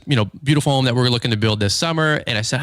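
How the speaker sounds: ordinary talk, nothing marked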